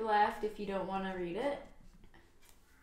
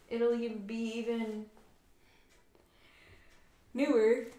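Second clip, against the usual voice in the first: yawning; 3 semitones higher, 6 dB louder than usual. Real, not acted. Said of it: "How are you yawning already?".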